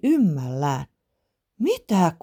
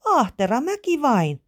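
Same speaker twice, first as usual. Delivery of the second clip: high-voiced child calling down; lightly voiced high child's call; little girl calling; 8 semitones higher than usual. A narrator's reading. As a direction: pipe it back in a small girl's voice. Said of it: "pikku Iida huusi".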